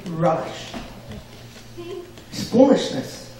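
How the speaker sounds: a feeble try at an English accent on "Rubbish"; failed English accent. Shown as a demonstration. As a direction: attempt "Rubbish" in an English accent and fall short. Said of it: "wanna do it in an English accent, but I can't".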